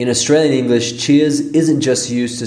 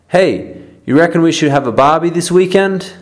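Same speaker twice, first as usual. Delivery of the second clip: high, lively invitation to a barbie; eager Aussie question; 6 semitones higher than usual. Acted. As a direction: pitch the barbie idea brightly. Acted Aussie dialogue example.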